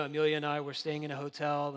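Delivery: normal talking voice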